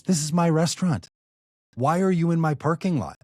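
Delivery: imitating a man defending his property; indignant owner. Owner telling the crew it is his lot, indignant.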